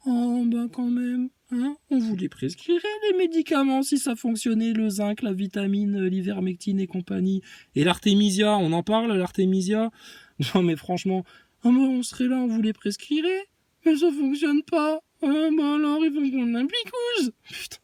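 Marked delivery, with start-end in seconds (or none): 0.1-7.4 honeyed little voice. Retold or imitated. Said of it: "la petite voix mielleuse qu'il prend". 11.6-12.4 very high sugary whine; honeyed little voice. Retold or imitated. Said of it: "la petite voix mielleuse qu'il prend". 12.4-13.5 honeyed voice. Retold or imitated. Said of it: "la petite voix mielleuse qu'il prend". 13.9-17.3 very high sugary whine; honeyed little voice. Retold or imitated. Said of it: "la petite voix mielleuse qu'il prend".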